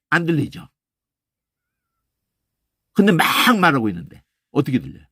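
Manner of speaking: ordinary delivery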